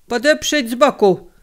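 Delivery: loud, high urgent command; shouted order. A narrator's reading. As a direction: bark the order to the crew. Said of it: "krzyknął sztygar Konderla".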